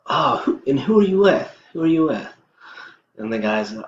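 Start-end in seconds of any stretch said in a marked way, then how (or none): 0.1-2.3 high, loud mock Trump quip. Vlogger mimics Trump questioning a CNN reporter, high and loud. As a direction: ham up Trump snapping back at the reporter. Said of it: "And then Trump sits there and he says".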